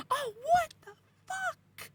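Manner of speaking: high, slow, shrieking fright; a drawn-out scared wail; terrified cry. Retold or imitated. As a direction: scream it as the claws reach you. Monster hands reach for her.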